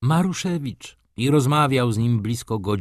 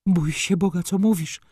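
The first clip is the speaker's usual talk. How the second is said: groaning in dismay; 10 semitones higher, 3 dB louder than usual. A narrator's reading. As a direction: cry out to God in shock. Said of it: "jęknęła matka".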